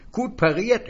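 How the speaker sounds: a high, eager little stranger selling words; high, wheedling salesman voice. Acted. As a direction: pitch it up like a pushy little peddler. Stranger's quip.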